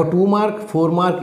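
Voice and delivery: ordinary talk, nothing marked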